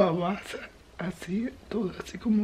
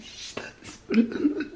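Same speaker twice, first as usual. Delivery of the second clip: whispering; 6 semitones higher, 8 dB quieter than usual. Real, not acted. Baby sleeping nearby. (susurro para no despertar al bebé)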